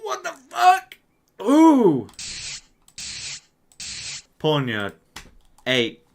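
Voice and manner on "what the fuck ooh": loud high startled shout; shocked yell. Real, not acted. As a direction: blurt out the shock at full volume. Shocked at a cry.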